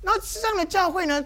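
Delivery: ordinary talk, nothing marked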